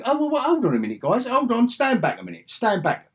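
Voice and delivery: high, hammed-up halting order; mock commander. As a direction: play the pilot calling the others off. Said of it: "didn't just say".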